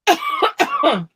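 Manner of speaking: coughing. Real, not acted. Just choked, coughing.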